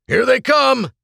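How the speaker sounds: high, loud shouted alert; yell. A narrator's reading. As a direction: yell a warning to everyone. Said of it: "James yelled".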